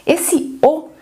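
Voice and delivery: usual speaking voice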